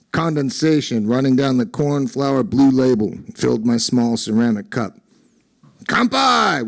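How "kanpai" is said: loud, high cheer of kanpai; shouted toast. A narrator's reading. Friends toasting in a bar, loud shout. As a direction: raise cups and shout it out. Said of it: "we shouted, much too loud".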